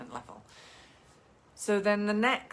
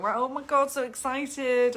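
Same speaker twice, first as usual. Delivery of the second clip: excited reply; 6 semitones higher, 5 dB louder than usual. Acted. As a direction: let the excitement spill over to the client. Photographer quotes excited reply.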